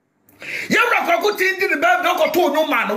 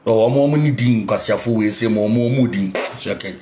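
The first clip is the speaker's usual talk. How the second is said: deep mocking voice. Ranting at a rival, mocking him in a deep voice. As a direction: drop way down into a mocking impression.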